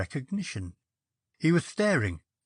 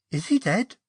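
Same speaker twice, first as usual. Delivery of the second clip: asked quickly; 8 semitones higher, 4 dB louder than usual. Acted. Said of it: "she asked quickly".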